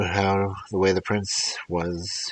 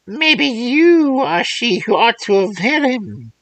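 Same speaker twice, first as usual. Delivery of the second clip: put-on old crone voice; 9 dB louder, slower than usual. Old woman voiced in fairy tale.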